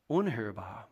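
ordinary talk

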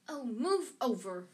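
impatient, drawn-out order; snarled. A narrator's reading. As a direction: push them aside with your voice. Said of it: "Hermione snarled".

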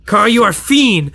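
high, punched-out denunciation; mock accusation. Time traveler denouncing Carl at rehearsal, high mock accusation. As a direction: hurl it at him with comic force.

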